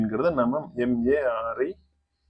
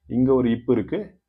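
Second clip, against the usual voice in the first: ordinary talk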